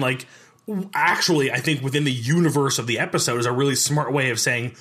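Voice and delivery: normal talking voice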